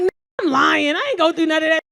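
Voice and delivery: high, loud backpedal; loud, exasperated brush-off; mock defensive shout. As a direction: shout him down, acting out the moment. Backpedalling shout at her alarmed man.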